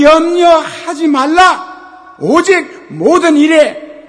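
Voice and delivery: loud, slow, ringing scripture proclamation; shouted pulpit reading; full-force preaching. Acted. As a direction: thunder the verse out to the congregation, stretching each phrase.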